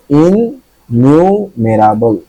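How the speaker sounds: ইননিউমেরাবল said slowly with a held double n; slowly sounded-out ইননিউমেরাবল; slow careful pronunciation. Shown as a demonstration. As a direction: stretch out ইননিউমেরাবল, leaning on the in. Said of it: "এর আগে একটা ইন আছে".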